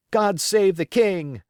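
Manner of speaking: high, loud, drawn-out cheer; a boy's proud hurrah; triumphant cry. Acted. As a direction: hail the king as you toss the flag. Striking the pirate flag triumphantly.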